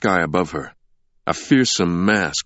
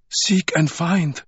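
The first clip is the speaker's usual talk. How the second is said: ghostly woman voice; 11 semitones higher, slower than usual. A narrator's reading. Woman's voice in vision.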